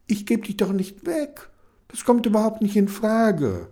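put-on high, firm girl's voice; Kenny voice. Kenny tells the salesman she keeps him, firmly. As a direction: play Kenny, high and determined.

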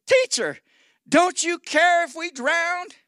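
high, drawn-out mock plea; alarmed plea. Disciples in a sinking boat to their teacher, alarmed plea. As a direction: cry out slowly, high-pitched, as a worried follower.

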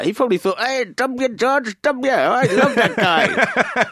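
lightly hammed-up American yokel praising George W; put-on American accent. Clerk imagined praising George W, in a mock American accent. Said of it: "You like my accent?".

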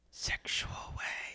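slow, hushed whisper. Acted. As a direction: whisper it slowly, as if it were scandalous. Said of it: "Why are we whispering?".

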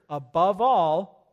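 "above all" slow and drawn out; stress on above all. Shown as a demonstration. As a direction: stretch "above all" for weight. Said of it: "top of the list".

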